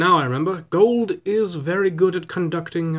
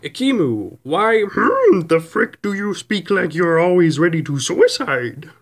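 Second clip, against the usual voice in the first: mock voice reading the question; put-on reading voice; 2 semitones higher, 5 dB louder than usual. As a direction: read it in a silly put-on voice. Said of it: "there's only one voice I could think to answer this".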